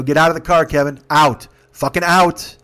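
loud, hard commands in short bursts; retold firm order. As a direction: order him out, punching each command. Teller orders Kevin out, retold.

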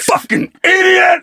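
slow, high-pitched mock shout; drawn-out, very high strained yell; imitated screaming. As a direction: mimic a screaming skateboarder's insult. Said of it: "he's also screaming".

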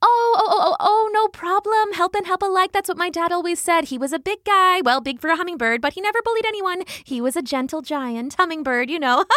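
high, rapid, bubbly rambling; chattering hummingbird. A narrator's reading. Hummingbird rambling about dad to no one in particular, rapid chatter. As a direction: prattle cheerfully and end in a giggle. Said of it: "still talking at a rapid pace".

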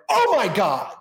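mock stunned recognition; imitating a flustered star. Imitating star's shock.

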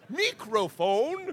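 slow, puzzled high Puritan; annoying Puritan voice. Retold or imitated. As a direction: stretch out the strange word. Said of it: "supposed to be in character, that annoying".

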